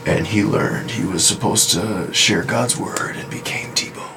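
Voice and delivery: slow breathy whispered narration. Retold or imitated. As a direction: breathe it out slowly, solemn. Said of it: "and then it's like".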